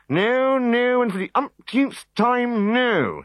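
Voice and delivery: high, slow firm refusal; high emphatic no; adamant druid. A narrator's reading. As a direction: refuse for the umpteenth time.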